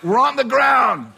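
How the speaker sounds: high, loud, stretched-out yell; exasperated shout. Acted. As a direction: shout the obvious back at him, slowly. Shouting back at screaming drunk.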